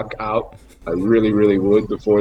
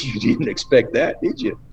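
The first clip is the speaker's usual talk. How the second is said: jovial teasing; 4 semitones higher than usual. Retold or imitated. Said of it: "very sort of a jovial way".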